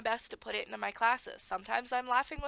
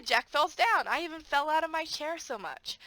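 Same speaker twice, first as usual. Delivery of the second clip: ordinary talk, nothing marked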